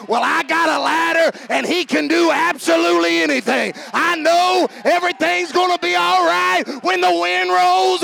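high, loud, ringing faith; confident believer voice. Retold or imitated. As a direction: proclaim it unshaken. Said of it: "Once you know".

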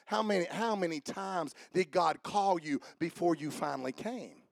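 normal talking voice

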